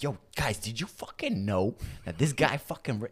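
high-pitched excited news to the guys; mock hyped announcement. Friend telling the guys about his guitar skill, hyped. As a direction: hype up a friend's secret talent.

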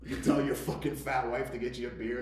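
ordinary delivery

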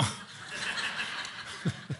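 quiet airy chuckle at himself; embarrassed little breath laugh; soft breathy laugh. Real, not acted. Phone interrupts the sermon.

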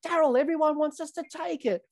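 very high put-on player's voice; mock pleading. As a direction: imitate a batsman appealing to the umpire, pitched right up. Acted plea from batsman to umpire.

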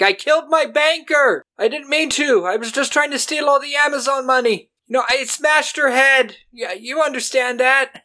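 very high, loud frantic yelling; frantic confession. Retold or imitated. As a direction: blurt it all out on stage. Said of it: "he just goes up on stage and he's like".

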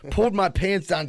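ordinary talk

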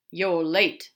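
loud, curt rebuke; stern boss. Acted. Gabriel rebuking Aziraphale on arrival, stern and loud. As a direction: pronounce it loudly, without greeting.

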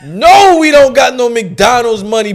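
high, loud, indignant mom imitation; scolding mother. Mother refusing her kids McDonald's, loud hammed-up scolding. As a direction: build to a mock-outraged yell.

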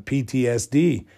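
ordinary delivery

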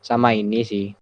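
ordinary talk, nothing marked

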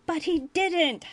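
high, loud protest; crying out. A narrator's reading. Ferengi boy protests to the captain, crying out. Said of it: "Nog cried".

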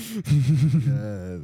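loud, high laughing fit; real hard laughter. Real, not acted. After the chill pills story, laughing hard. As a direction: crack up completely.

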